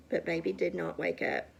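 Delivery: plain reading voice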